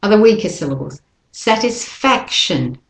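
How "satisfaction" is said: "Satisfaction" slow, sat and fac strong, tis and shun weak; slow model word. Shown as a demonstration. Teacher models after explaining weak syllables, slow. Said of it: "the tis and the shin are the weaker".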